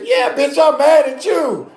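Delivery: loud high ranting, half laughing; mock angry rant. Comedian imagining telling her off, mock angry. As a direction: let her have it, hammed up. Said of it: "I wanted to say".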